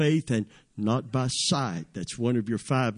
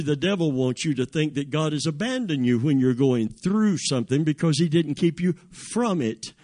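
ordinary talk

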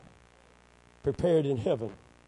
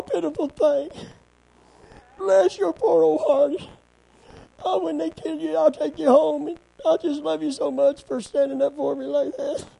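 high-pitched, put-on sympathetic coo; mock pitying small voice; 12 semitones higher, 4 dB louder than usual. God mockingly pitying Elijah in the cave, high cooing voice. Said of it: "A still, small voice came".

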